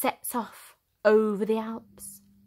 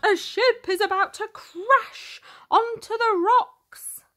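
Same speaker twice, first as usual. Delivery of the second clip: high, louder urgent call; urgent shout; 11 semitones higher, 4 dB louder than usual. Acted. The Coast Guard warning of the ship. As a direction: warn of the crash. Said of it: "they shout".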